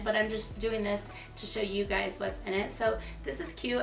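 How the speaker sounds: ordinary talk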